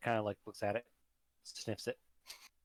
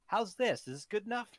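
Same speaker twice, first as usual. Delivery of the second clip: put-on high-pitched child voice; 10 semitones higher, 3 dB louder than usual. Penumbra holds up plushie.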